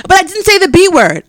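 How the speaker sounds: put-on high, loud protesting child; loud, high mock kid denial; defensive kid. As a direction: whine the denial like a caught fifth grader.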